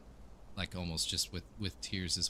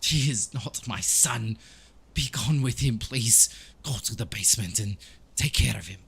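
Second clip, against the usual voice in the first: put-on higher, accented, emphatic plea; anguished priest; 3 semitones higher than usual. Priest sending adventurers to the basement, firm and anguished. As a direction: deny him firmly, then plead. Priest sends party to slay son.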